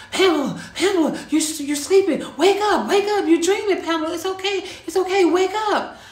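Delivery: frantic yelling. Retold or imitated. Husband shouting to wake her from a nightmare, frantic yell. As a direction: yell urgently to shake someone awake. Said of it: "screaming and yelling right now".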